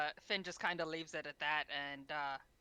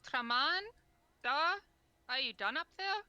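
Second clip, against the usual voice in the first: higher, louder call up to someone; raised voice; 9 semitones higher, 6 dB louder than usual. Acted. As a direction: call up to the rooftop, pitch rising. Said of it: "he definitely raised his voice to".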